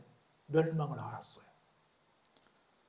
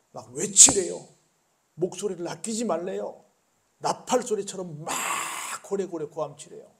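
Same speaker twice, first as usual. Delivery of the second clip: louder, building proclamation; rising exclamation; 3 semitones higher, 8 dB louder than usual. Acted. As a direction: let it swell into a cry. Preacher building up, quoting God's command.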